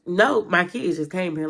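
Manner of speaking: ordinary talk, nothing marked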